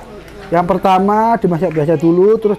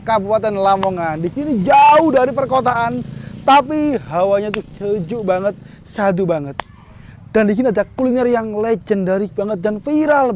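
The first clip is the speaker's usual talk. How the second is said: vlog intro; 5 semitones higher than usual. Acted.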